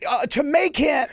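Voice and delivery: high, loud, hammed-up indignant; loud mock protest, slow on what did she say; mock outraged dude. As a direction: play the guy who can't believe what he heard. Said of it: "there's always that one dude who's like".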